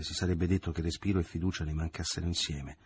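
usual speaking voice

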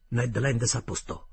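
ordinary delivery

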